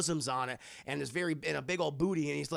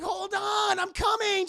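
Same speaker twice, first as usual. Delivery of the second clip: much higher, louder lady voice; high shouted reply; 5 dB louder than usual. Acted. Willie shouting back to Indy, flustered.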